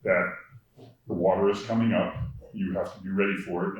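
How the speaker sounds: usual speaking voice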